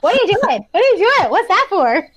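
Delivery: high-pitched mock nag; nagging host. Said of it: "when Nicole nags the other contestant".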